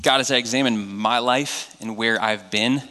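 soft, low prayer voice. Acted. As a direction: pray quietly and sincerely. Opens prayer.

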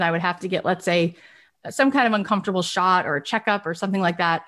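normal talking voice

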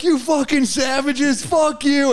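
mock furious yell at the airline; retold yelling. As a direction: rant at the airline staff, hammed up. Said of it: "we're yelling at".

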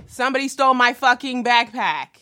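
loud, exasperated reveal; exasperated outburst. Retold or imitated. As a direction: blurt it out, fed up. Punchline of theft story, outburst.

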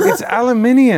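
emphatic mock-British pronunciation; British accent. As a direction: show off a put-on British accent. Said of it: "I said it in a British accent".

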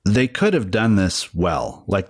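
ordinary delivery

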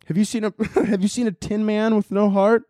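high British-tinged Dorothy voice, laugh breaking in; high, silly British girl from Kansas; mock British Dorothy. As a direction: play Dorothy with a put-on British lilt. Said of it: "why is she British".

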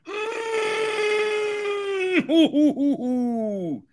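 long high burst of laughter. Real, not acted. Laughs at his own silly noises.

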